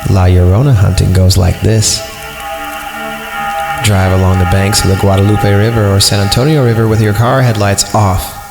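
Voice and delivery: normal talking voice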